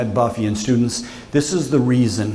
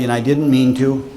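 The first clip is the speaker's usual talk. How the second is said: ordinary delivery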